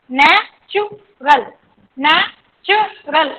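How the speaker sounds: Natural slowly split as नै, चु, रल; syllable by syllable. Shown as a demonstration. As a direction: pause after each syllable of natural. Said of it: "syllables कितने हो गए". (Natural तीन टुकड़ों में, धीरे)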